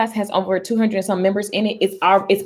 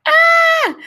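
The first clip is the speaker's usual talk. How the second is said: high, loud "ah"; piercing happy cry; excited squeal; 6 dB louder than usual. Real, not acted. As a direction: let out a long squeal. Delighted by her viewers.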